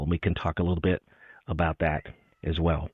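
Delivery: normal talking voice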